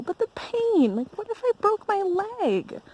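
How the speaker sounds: hammed-up anxious pleading; mock dread. Acting out worry about getting hurt.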